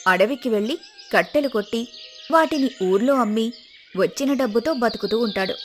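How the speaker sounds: usual speaking voice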